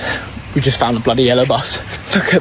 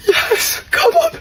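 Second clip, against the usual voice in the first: excited cheer; much slower than usual. Real, not acted. Cheering on yellow bus.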